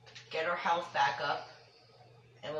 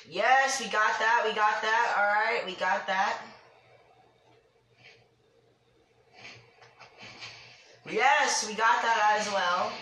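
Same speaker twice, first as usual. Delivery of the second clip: louder, higher triumphant cheer; excited; 3 semitones higher, 5 dB louder than usual. Real, not acted. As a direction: celebrate each hit. Hitting targets in game.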